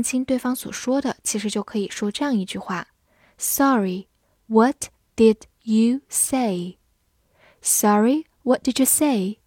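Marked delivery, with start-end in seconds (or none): 3.4-6.8 words pulled apart slowly; slow model reading of the question. Shown as a demonstration. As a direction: say it slowly so students catch each word. Teacher models slow then fast.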